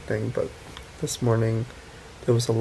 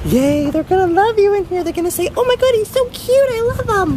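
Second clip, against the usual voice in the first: put-on much higher, cooing baby talk to a puppy; 8 dB louder than usual. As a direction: coo at the new puppy, sing-song and delighted.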